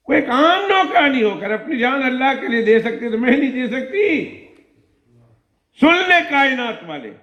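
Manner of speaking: queen stirring herself to defy Pharaoh; fervent queen. Acted. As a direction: rise to a defiant vow. Queen resolves aloud.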